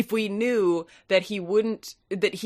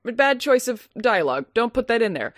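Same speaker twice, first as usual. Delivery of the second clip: usual speaking voice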